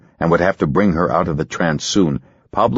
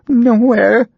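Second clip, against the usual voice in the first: much higher, distant; hollow frightened answer; 6 dB louder than usual. Acted. Ginger in trance, high and frightened. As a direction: answer from far away in fear.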